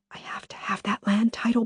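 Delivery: desperate whispered plea; Lily whispering her plea to Benny down the phone; urgent whisper. Acted. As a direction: whisper it, pressing hard.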